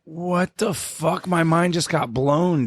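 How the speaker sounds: slow, high, drawn-out amazement; stunned disbelief. Real, not acted. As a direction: say it like your mind just got blown. Mind blown reaction.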